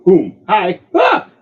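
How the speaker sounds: high, loud, sudden startled yelp; cartoonish alarm as it blows; 1 loud mock yelp. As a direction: throw out a big surprised cry. Grenade goes off in scene.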